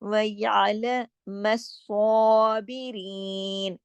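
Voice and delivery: व यालम स्वाबिरीन with throat ع and heavy स्वा; very slow Quranic reading; slow recitation. Shown as a demonstration. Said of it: "हलक से आवाज आनी चाहिए".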